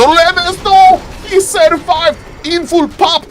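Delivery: excited caster, pitched high; shocked live reaction. Real, not acted. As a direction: call out the narrow escape in disbelief. Shock at a game moment.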